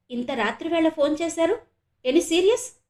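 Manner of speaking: a startled father fearing bad news; anxious. A narrator's reading. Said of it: "ఆతృతగా అడిగాడాయన".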